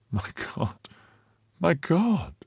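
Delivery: stunned friend reacting to a horrible story; stunned cry. A narrator's reading. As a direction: whisper the first "My God!", then cry the second out high. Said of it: "cried D'Artagnan, quite stunned".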